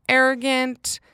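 usual speaking voice

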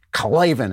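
"Klavan" drawn out with relish; showy name delivery. Shown as a demonstration. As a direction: announce "Klavan" with a flourish, stretching the start. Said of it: "you gotta say it like that".

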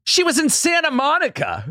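high, stretched-out quote. Retold or imitated. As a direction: say it with wide-eyed emphasis.

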